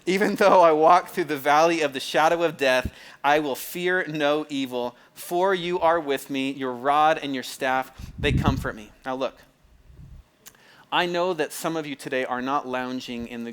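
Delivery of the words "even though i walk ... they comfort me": loud, slow, ringing scripture recitation; solemn psalm cadence. Shown as a demonstration. Preacher showing the cadence he slips into, loud slow recitation. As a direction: proclaim each phrase slowly, like a grand reading. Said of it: "that, that cadence".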